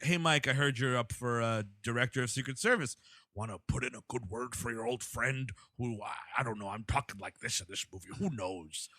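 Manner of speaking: low, soft, gravelly put-on mumble; mock Danny Houston gruff voice. Friend mocking Danny Houston's gruff movie voice, low murmur. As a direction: drop way down and murmur like a sly crook. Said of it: "he's like".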